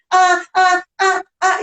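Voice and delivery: put-on high, repeated "Ah" like a stuck child; "Ah" repeated as a child's stammered block; imitated stuttering. Shown as a demonstration. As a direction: get stuck on "Ah" in separate bursts. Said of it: "A criança tá parada lá".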